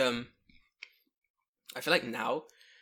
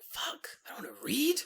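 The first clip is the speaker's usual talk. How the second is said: put-on breathy whispered complaint as a kid; a kid muttering under the breath; whispered groan; 12 semitones higher than usual. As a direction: whisper it like a sulky child. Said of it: "And I'm like".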